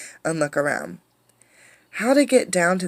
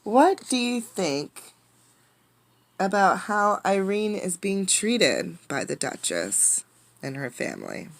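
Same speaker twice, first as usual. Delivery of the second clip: put-on reading to children; 4 semitones higher than usual. Question to young listeners.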